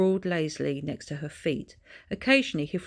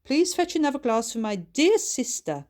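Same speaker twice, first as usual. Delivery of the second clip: calling out; 8 semitones higher, 5 dB louder than usual. A narrator's reading. Lady Ludroth calling out.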